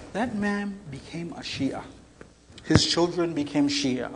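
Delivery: ordinary delivery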